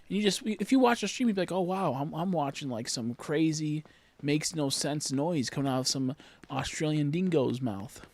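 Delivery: ordinary talk, nothing marked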